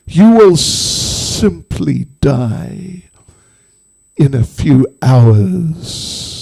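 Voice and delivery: slow snake with long, hissing esses; menacing snake voice. Acted. Snake explaining its punishment to the old woman, sinister. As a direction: pronounce the threat slowly, drawing out the sibilants. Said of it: "And the snake said".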